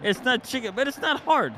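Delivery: high-pitched, laughing objection; exasperated protest. Real, not acted. Protesting through laughter about crab.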